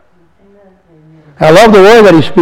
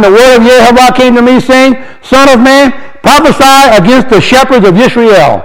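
scripture reading; 5 semitones higher than usual. Acted. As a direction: read the verse like a proclamation. Reads scripture aloud.

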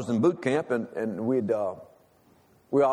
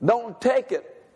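preaching at full force; 8 semitones higher than usual. Acted. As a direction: preach it out to the church.

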